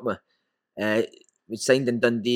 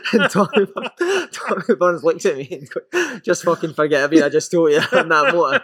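laughing while telling; 5 semitones higher, 3 dB louder than usual. Real, not acted. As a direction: tell it through laughter.

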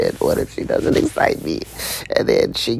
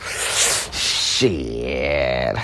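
usual speaking voice

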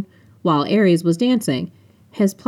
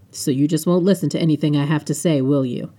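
ordinary delivery, nothing marked

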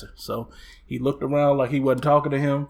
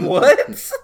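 high, loud, stunned What; 9 semitones higher, 8 dB louder than usual. Real, not acted. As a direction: shoot the What up, loud and amazed. Stunned by the news.

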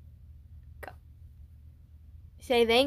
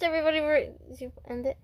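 usual speaking voice

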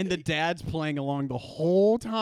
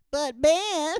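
high, loud mock protest; sidekick voice; 7 semitones higher, 7 dB louder than usual. Sidekick objecting to the hero, high mock protest. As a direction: pipe up like a whiny sidekick. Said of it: "he's gonna say things like".

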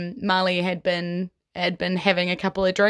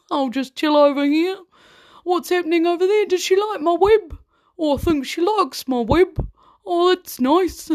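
much higher, meek little spider voice; Stephen the spider voice; 10 semitones higher, 3 dB louder than usual. Retold or imitated. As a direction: play a timid, content little spider in his corner. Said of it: "out came Stephen's voice".

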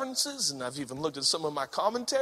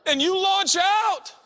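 loud, high, pounding preacher shout; full-force preaching; 11 semitones higher, 8 dB louder than usual. Acted. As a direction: drive it home to the congregation.

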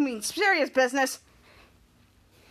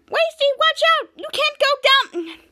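much higher and louder panicked cry; alarmed warning; 11 semitones higher, 5 dB louder, slower than usual. Retold or imitated. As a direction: shout a frantic warning. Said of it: "Cut to Mika said".

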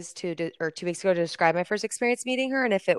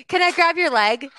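much higher and louder question; eager loud ask; 10 semitones higher, 8 dB louder than usual. Retold or imitated. Friend rushing in to pose with [name], loud high eager question. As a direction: blurt it out, excited.

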